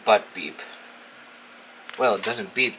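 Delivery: usual speaking voice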